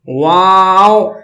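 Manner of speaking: واو with both lips rounded, long-held; drawn-out واو closing on a round w. Shown as a demonstration. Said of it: "دونوں ہونٹوں کو گول کریں گے".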